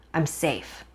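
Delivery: usual speaking voice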